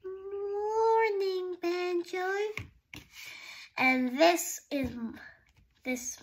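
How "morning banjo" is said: a lightly voiced, higher sleepy koala. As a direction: greet Banjo like a koala just waking up. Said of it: "my sleepy friend".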